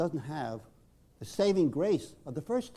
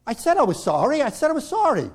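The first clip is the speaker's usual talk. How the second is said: loud, much higher defensive protest; 9 semitones higher, 6 dB louder than usual. Retold or imitated. Rob defending himself to the group, loud. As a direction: plead hurt and defensive. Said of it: "He's taken aback".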